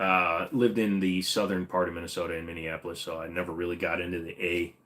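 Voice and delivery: ordinary delivery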